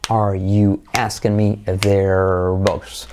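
low, very slow linked phrase; drawn-out rhythmic repetition for learners; rhythmic drill. Shown as a demonstration. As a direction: model it slowly on a steady beat. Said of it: "la hacemos con ritmo".

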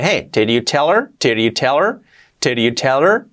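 "did you tell her" as "deriu teler", rising; linked, H omitted. Shown as a demonstration. Teacher modelling native speech, linked with dropped h. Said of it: "la H de her la podemos omitir".

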